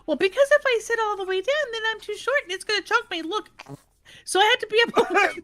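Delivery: put-on high, fast, breathless little girl voice; excited little girl. Granddaughter to grandma about the car seat, high excited child voice. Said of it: "I said".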